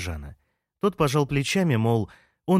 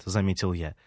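ordinary delivery

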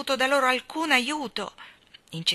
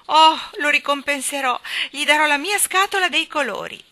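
put-on loud, higher, eager child voice; pleading little girl; 6 semitones higher, 7 dB louder than usual. Jane offering her paints to make amends, pleading. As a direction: bargain eagerly, voice raised. Frightened Jane bargains for release.

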